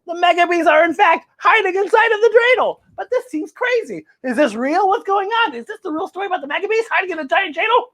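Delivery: put-on high girl voice, rushed at the end; a little girl who can't believe it; amazed girl. Girl's amazed questions.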